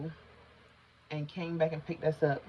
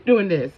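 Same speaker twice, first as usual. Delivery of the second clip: panicked gasping; 2 semitones higher, 11 dB louder than usual. Retold or imitated. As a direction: gasp and sob through a tissue. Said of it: "I'm holding the tissue like this".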